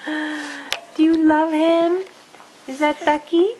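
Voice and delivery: high, slow cooing question. Real, not acted.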